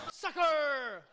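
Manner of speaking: high, laughing mock kid's sucker; high playful child's taunt with a laugh; cheeky sucker. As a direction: toss it out with a giggle like a boy. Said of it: "Like that".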